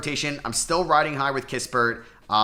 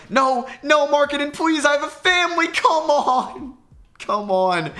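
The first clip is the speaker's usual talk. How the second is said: high, loud, desperate begging; frantic pleading; 5 dB louder than usual. Real, not acted. Gamer begs Markkanen to miss, frantic high pleading. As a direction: beg the shooter to miss, panicking. Opponent shoots a crucial three.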